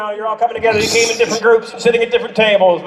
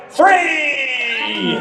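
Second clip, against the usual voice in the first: yelled cue; 5 semitones higher than usual. Shown as a demonstration. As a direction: shout the number high and drawn out. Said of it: "When I get to three".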